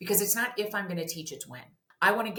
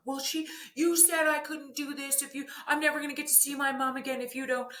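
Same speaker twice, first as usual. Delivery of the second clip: put-on high, childlike voice blurting out a fear; upset child; 8 semitones higher than usual. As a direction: play a scared kid explaining what they heard. Upset child answers parent.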